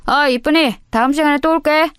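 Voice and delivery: high, sing-song leering flirt; sleazy teasing goodbye; flirty leer. Acted. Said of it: "찝쩍대는 것을".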